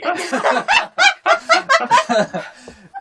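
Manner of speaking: bursting into a shrill laugh; cracking up at herself; loud high giggle. Real, not acted. Giggling after her anime voice.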